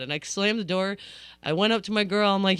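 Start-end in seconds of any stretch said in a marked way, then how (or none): none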